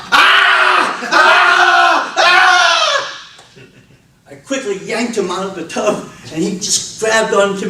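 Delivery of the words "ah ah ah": high, loud mock baby wails; imitating a baby's cry. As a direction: squeal like a startled infant. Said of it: "and cried out".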